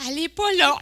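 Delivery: high, loud comic complaint; mock exasperation. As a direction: hit the repeated no-show harder, near laughing. Même constat, deux jours plus tard. (exaspération comique, plus appuyée)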